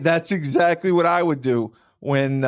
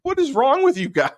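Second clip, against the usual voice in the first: mock exasperation. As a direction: play Kyle fed up and pleading. Kyle exasperated, voiced.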